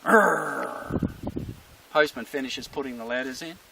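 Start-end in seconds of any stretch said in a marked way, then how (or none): 0.0-1.1 loud rolled growl; imitated dog's growl. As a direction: growl like a warning dog. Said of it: "so he growls".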